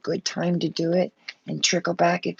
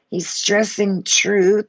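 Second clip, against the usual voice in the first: "truth" drawn out slow and louder; slow, land hard on "truth"; stress on truth; 3 semitones higher, 5 dB louder, slower than usual. Real, not acted. Reader to viewers, stressing "truth" slowly.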